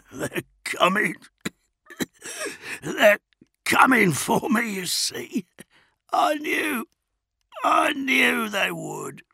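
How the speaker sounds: high, broken, wheezing gasps; ragged, coughing laughter. A narrator's reading. Tortured prisoner gloating about rescue, ragged and breathless. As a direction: force it out between wet coughs. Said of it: "began to laugh, but it turned into a wet, ragged cough".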